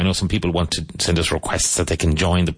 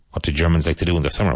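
quick drill question; much faster than usual. Shown as a demonstration. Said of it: "question drill".

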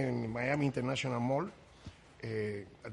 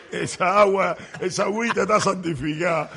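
words pushed out through real laughter, louder; a touch of slow, laughing delivery at the end; laughing while talking; 5 semitones higher, 11 dB louder, slower than usual. Real, not acted. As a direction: laugh your way through the joke. Laughing at his own joke.

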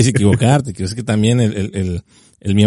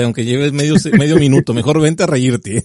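ordinary talk, nothing marked

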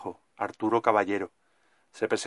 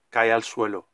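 ordinary delivery

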